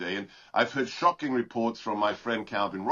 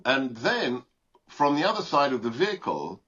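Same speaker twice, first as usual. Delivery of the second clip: usual speaking voice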